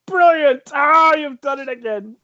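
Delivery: hammed-up delight at your own genius; self-admiring writer. Writer congratulating himself on his own book, hammed-up high delight. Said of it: "who's laughing out loud uproariously when he's writing".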